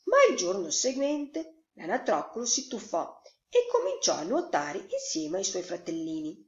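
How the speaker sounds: plain reading voice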